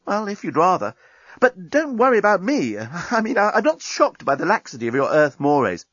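high-voiced, chatty reassurance; airy high character voice; blithe journalist. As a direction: babble on obligingly with a small laugh. Journalist chatters on to annoyed Dan.

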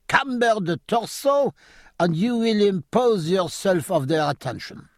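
a hint of French, coaching with relish; French accent. A narrator's reading. French officer coaching his captain's pose, light French accent.

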